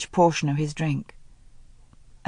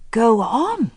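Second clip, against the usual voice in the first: higher, sharp disbelief; indignant; 5 semitones higher than usual. Acted. Helen echoing Tommy in indignant disbelief. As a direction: throw the words back at him. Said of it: "all her anger return in a rush".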